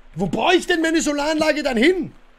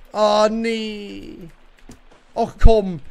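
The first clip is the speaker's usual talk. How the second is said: frustrated; 5 dB louder, much slower than usual. Real, not acted. Building fails again, frustrated.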